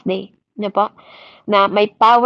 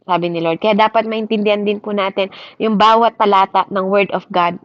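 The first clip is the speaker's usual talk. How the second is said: usual speaking voice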